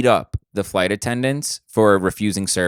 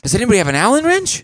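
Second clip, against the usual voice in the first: higher, fast hammed-up urgency; mock hero; 11 semitones higher, faster than usual. Cohost joins the bit, playing the hero demanding tools. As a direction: shout for tools like a movie hero.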